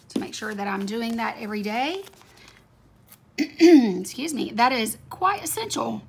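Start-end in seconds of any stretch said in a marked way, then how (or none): 4.5-6.0 hoarse, scratchy throat. Real, not acted. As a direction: talk through a scratchy, hoarse voice. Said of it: "I do have a very scratchy voice".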